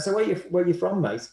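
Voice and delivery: lightly voiced Cockney question; a hint of a London Cockney asking; casual Cockney mate, barely put on; Cockney accent. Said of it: "in a Cockney accent".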